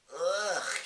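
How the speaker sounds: loud high disgusted ugh; disgusted splutter. Acted. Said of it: "she spluttered".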